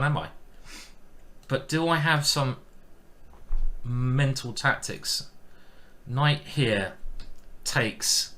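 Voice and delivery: usual speaking voice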